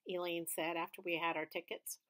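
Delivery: ordinary delivery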